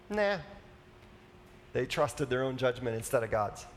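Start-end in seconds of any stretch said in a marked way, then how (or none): 0.1-0.4 a flippant, high-pitched nah; dismissive nah. Retold or imitated. Adam and Eve brushing off God's command, flippant. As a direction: toss it off like you couldn't care less.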